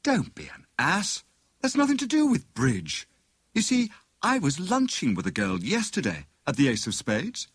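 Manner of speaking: excited scheming, understated; Tuppence, eager. A narrator's reading. Tuppence explains her plan.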